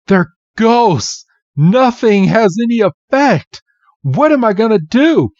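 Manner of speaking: high, loud, frightened pleading; rising panic with nowhere to run; panicked despair. Retold or imitated. Red realizes nothing works on the ghosts, panicking. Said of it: "And Red's like".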